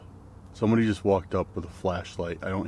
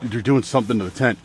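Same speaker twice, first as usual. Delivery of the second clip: ordinary talk, nothing marked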